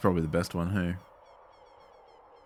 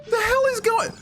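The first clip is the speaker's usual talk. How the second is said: shocked shriek; slower than usual. Real, not acted. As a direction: squeal it in total disbelief. Gamer shocked by game event.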